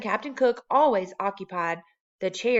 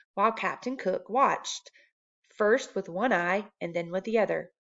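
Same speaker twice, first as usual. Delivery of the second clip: usual speaking voice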